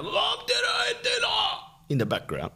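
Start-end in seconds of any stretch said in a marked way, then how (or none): 0.0-1.3 put-on very high, squealed child holler; high-pitched mock kid shout; imitated son's yell. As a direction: mimic a small boy yelling from another room. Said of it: "my son yell".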